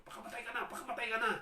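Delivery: very high, loud, taunting shout; demon urging suicide. Acted. Preacher voicing the demon who dictates to the possessed man, high loud shout. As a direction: bellow it like a demon pushing a man to die. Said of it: "na dinidikta siya".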